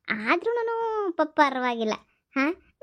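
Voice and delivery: usual speaking voice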